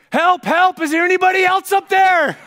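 very high, loud cry for help; sudden yell. Retold or imitated. Jack rejects God's advice, suddenly yelling for help. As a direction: yell past God for someone else. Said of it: "all of a sudden he yells".

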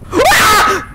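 high, loud short yelp of fright; startled scream. Real, not acted. Player jumpscared at the bush, startled yell. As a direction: shriek at the jumpscare.

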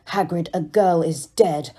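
ordinary delivery

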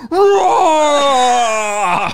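loud monster roar; big scary roar. Retold or imitated. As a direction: roar to scare the kid awake. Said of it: "as my father went".

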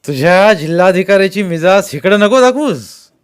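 loud, high, scornful comeback; shouted retort. A narrator's reading. Masked man scorns the collector's airs, shouting back. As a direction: shout him down over the crowd. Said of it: "तोही ओरडून म्हणाला".

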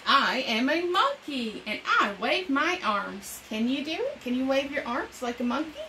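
put-on bright, lively read-aloud for young kids; storytime reading. Reading an animal picture book to children, bright storytime voice.

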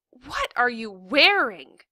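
slow, loud motherly exclamation; mom calling out. A narrator's reading. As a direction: call down the hall in disbelief. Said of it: "My mom called".

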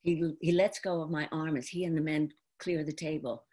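normal talking voice

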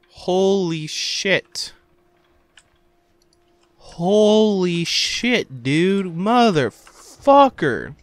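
slow, stretched amazement; awed drawl. Real, not acted. Stunned by the horror scene, slow. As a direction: let each word hang.

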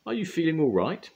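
high, loud concerned question. A narrator's reading. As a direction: check on her kindly. Sunshine asks Dotty.

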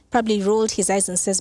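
ordinary talk

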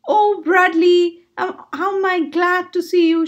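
crying out. A narrator's reading. Ivy greeting her rescuer Bradley, relieved cry. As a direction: draw out the opening cry, glad to be found. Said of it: "she cried".

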